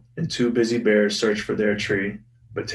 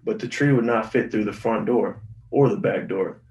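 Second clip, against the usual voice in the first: ordinary talk, nothing marked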